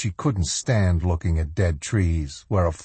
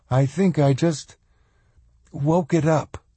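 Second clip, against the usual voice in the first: higher, unsure character voice; young woman's voice; 8 semitones higher than usual. A narrator's reading. Swan answering Carla about the tree, tentative. Same girl explains, halting.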